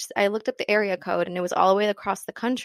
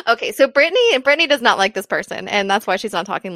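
loud amused announcement; 9 dB louder than usual. Real, not acted. Laughingly explains co-host's pointed silence.

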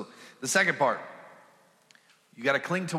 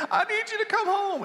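high, laughing imitation of a wife begging; husband laughingly mimics his wife's call home; mock pleading wife. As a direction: squeak it out like a panicked wife on the phone, laughing. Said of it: "You're calling me saying".

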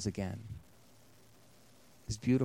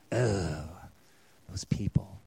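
disdainful groan; 8 semitones lower, 6 dB quieter, slower than usual. Acted. Preacher voicing a disdainful god.

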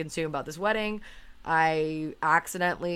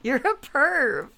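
high, loud laughing accusation; 11 semitones higher, 8 dB louder than usual. Real, not acted. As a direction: tease her through laughter. Teasing accusation while laughing.

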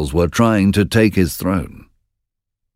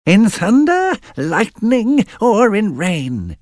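very high, slow cackling witch; slower than usual. Acted. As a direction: drag it out like an old hag. Witches speak.

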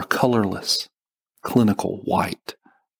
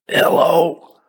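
hoarse, rough, loud first word after waking; coarse voice; 8 semitones higher, 6 dB louder than usual. A narrator's reading. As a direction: croak it out louder and higher. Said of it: "I coarsely asked".

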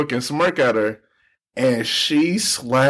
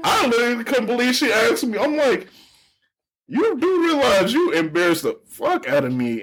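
incredulous; 10 semitones higher than usual. Retold or imitated. Still incredulous retelling.

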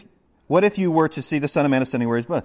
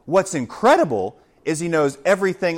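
ordinary talk

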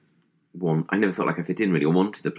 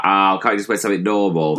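louder mock parental complaint; exasperated parent; 5 dB louder than usual. Parents objecting to his suits, mock exasperation. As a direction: plead like a fed-up parent, hammed up.

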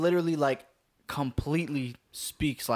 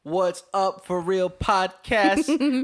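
podcast intro; 3 semitones higher, 3 dB louder, much slower than usual. Acted. Show opening greeting.

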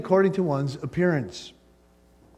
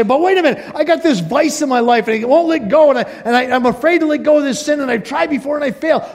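much higher and louder, pleading objection that speeds up; anxious protest; 10 semitones higher, 6 dB louder, faster than usual. Acted. Preacher plays a believer pleading he can't quit sin, high and urgent. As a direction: blurt out the fear of failing.

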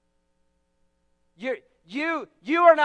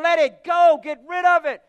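emphatic shout; 2 semitones higher, 7 dB louder than usual. Retold or imitated. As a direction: bellow it like a preacher's order. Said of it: "he's saying".

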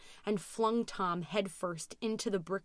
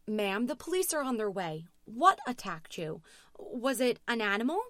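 raised, pressing questions; urgent dispatcher; 4 semitones higher, 5 dB louder than usual. A narrator's reading. Dispatcher asking Louise what attacked her, urgent. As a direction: press for answers with growing concern.

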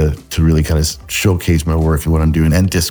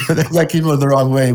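laughing through the words, pitched up; embarrassed laugh-talk; 7 semitones higher than usual. Real, not acted. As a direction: say it still laughing. Laughing after awkward remark.

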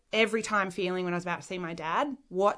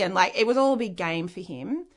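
usual speaking voice

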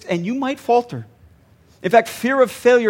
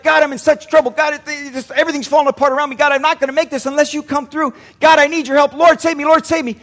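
hammed-up plea, higher and urgent; desperate prayer; 6 semitones higher, 4 dB louder, faster than usual. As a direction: beg God for rescue in a mock panic. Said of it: "it's kinda like this".

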